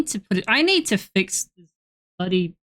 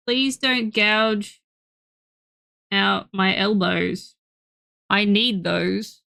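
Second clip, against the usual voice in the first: slow pleading to pet; 2 semitones lower, slower than usual. Real, not acted. Pet clawing her elbows.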